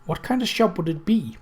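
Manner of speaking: usual speaking voice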